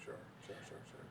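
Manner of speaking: very soft throwaway sure sure; muttered sures. Real, not acted. Brushing off the co-host's point, very soft sures. Dismissive agreeing.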